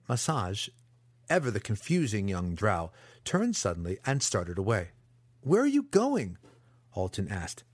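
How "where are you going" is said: much higher, urgent question; alarmed question. Acted. Captive calls after the departing apprentice, alarmed. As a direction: call after him in alarm, pitched up.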